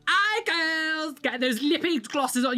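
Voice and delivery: put-on high, camp call to the girls; camp greeting. Mimicking his former self to girls, high camp voice. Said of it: "You don't go from".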